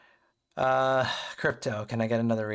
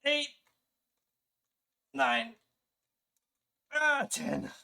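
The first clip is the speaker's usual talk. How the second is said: strained counting through push-ups; grunting out each rep; straining counting; 13 semitones higher, much slower than usual. Real, not acted.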